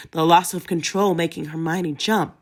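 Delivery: ordinary delivery, nothing marked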